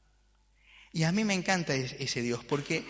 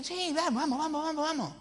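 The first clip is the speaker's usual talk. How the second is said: Dios entusiasta; 12 semitones higher than usual. Acted. Dios accede con entusiasmo.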